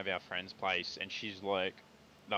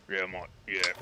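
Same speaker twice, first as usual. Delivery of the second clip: hard bloke voice; 3 semitones lower than usual. Retold or imitated. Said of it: "the way he talked was real hard".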